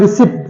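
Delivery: receipt as short "ri-sip"; wrong pronunciation. Retold or imitated. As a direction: say receipt with its P sounded. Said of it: "it's not receipt".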